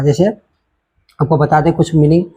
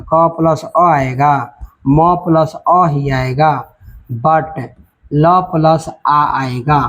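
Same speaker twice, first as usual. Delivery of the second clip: slow syllable breakdown; much slower than usual. Shown as a demonstration. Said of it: "teacher breaks word into syllables".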